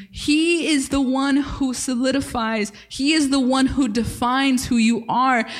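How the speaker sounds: ordinary talk, nothing marked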